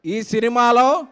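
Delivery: normal talking voice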